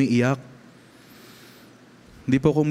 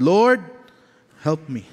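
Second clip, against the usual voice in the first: desperate cry; 3 semitones higher, slower than usual. Retold or imitated. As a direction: call out like a drowning man. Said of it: "siya po'y nalulunod". (sigaw na "Lord", mataas at malakas, parang nalulunod)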